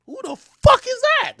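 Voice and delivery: very high, loud disbelief; baffled outburst. Retold or imitated. Man who never heard of him, baffled high outburst. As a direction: squawk it in total bafflement. Baffled reply to his date.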